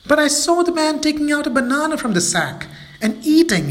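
high, protesting monkey voice; bewildered little monkey. Acted. Mabel protesting to friends, high and puzzled. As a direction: insist in disbelief.

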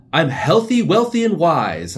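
high, loud, slow pompous proclamation; old man's weird accent. Retold or imitated. Mocking the old boyfriend's pompous motto. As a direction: proclaim it like a smug sage. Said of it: "He'd always be like".

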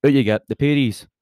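lightly acted order to leave; barman throwing out. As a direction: play a barman ejecting a customer. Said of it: "And the barman says".

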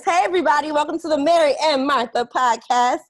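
bright, lifted podcast welcome; excited greeting. Real, not acted. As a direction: open the show with a big lift. Hosting intro greeting.

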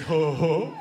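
put-on dopey teenage "huh" laughter; dim-witted adolescent chuckling; teenage boy. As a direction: chortle dumbly like a pack of boys. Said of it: "every teenage boy sounds like that".

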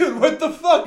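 high, loud outraged yell; shocked yell. Retold or imitated. Retelling yelling at the man who grabbed him. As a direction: shout it in disbelief.

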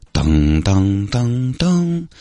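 slow sung ding-dong chime; imitated chime. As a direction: hum the airport chime slowly. Mimics PA chime.

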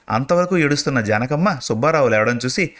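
usual speaking voice

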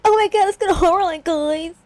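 high, fast mock-kid excitement; imitated kid squeal, rushed and high; excited son. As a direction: gush it like an over-eager boy. Voicing excited son in retelling.

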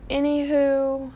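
ordinary delivery

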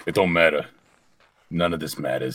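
low character voice, loose grammar; Carl. Acted. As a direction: drop low and say it dismissively. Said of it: "Do the Carl".

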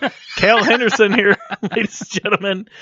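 high, loud show-host introduction; announcer sign-off. Real, not acted. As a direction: present the guest to the crowd.

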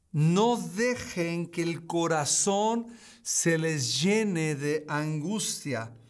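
slow, deliberate reading of the verse; read very slowly. Shown as a demonstration. Preacher reads the comforting verse slowly to listeners. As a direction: let every word land, unhurried. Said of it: "lo voy a leer muy despacio".

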